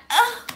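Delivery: high, loud frustrated "ugh"; stressed groan. Shown as a demonstration. Vlogger groaning anxiously to show stress. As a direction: groan out in worry. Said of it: "it can have stress to it".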